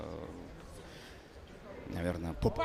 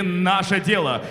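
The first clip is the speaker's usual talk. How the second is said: boxing ring announcer; 8 semitones higher, 9 dB louder, slower than usual. Acted. Announcer reading title.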